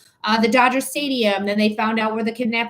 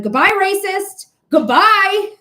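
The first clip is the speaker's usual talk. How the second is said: much higher, louder mocking goodbye; sing-song yell after her as she leaves; taunting shout; 9 semitones higher, 7 dB louder, slower than usual. Retold shouting at racist leaving.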